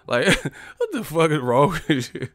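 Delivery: normal talking voice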